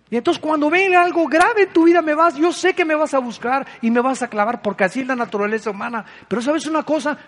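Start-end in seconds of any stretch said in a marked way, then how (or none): none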